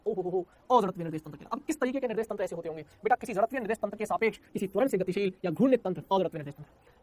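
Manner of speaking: normal talking voice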